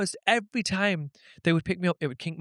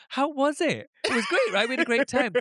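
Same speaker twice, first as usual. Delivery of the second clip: high, gushing fake reminiscing; mock-chummy, pitched up with a laugh; pretend familiarity; 7 semitones higher than usual. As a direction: bluff through a memory with put-on enthusiasm. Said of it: "let's pretend".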